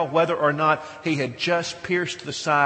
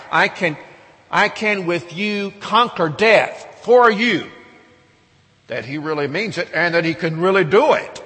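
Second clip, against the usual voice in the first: louder, slower and higher, driving it home; preaching at full force; 4 semitones higher, 8 dB louder than usual. Acted. Preacher pressing the congregation on the resurrection, loud and slow. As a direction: build to a ringing climax.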